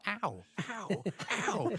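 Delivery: high-pitched yelps of pain, mimicked; imitated shot victim. Actor retells a guy being shot on set, imitated pained yelps. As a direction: yelp like someone just hit. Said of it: "the guy being shot".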